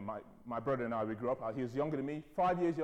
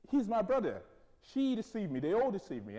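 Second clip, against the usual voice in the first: indignant protest; 6 semitones higher, 3 dB louder than usual. Acted. Acting out Abimelech's indignant protest.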